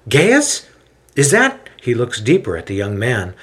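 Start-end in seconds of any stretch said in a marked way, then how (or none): 0.1-1.7 high, loud disbelieving call; surprised recognition. A narrator's reading. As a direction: call the name in astonishment. Paul recognizes old friend.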